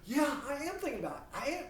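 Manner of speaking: hasty reassurance. Pretend. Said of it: "Or Honey could be saying".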